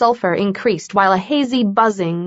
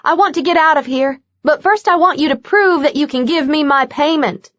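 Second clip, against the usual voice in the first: higher, fast defiant demand; defiant woman; 6 semitones higher than usual. A narrator's reading. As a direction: stand up to him, quick and firm.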